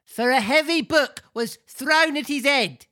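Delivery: put-on high comic old man voice; crying out as old man. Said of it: "cried the old man".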